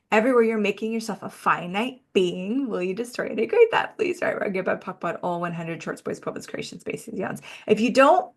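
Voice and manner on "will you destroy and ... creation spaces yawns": quick ritual chant; rapid recitation. Acted. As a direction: rattle off the clearing formula. Recites the clearing statement.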